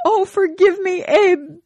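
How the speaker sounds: very high, loud, pleading falsetto; hammed-up begging wife. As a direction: beg him in a high wailing voice. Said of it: "begged Esther".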